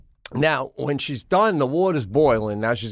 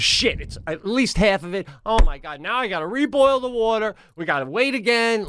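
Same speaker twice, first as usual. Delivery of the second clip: high, dismayed voice of the wife; imitating wife; 9 semitones higher than usual. Imitating his wife cursing after spilling the pasta. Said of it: "She goes".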